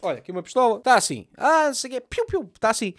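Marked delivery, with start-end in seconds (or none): 1.4-2.5 gun noises. Retold or imitated. Mimics gunman waving pistol.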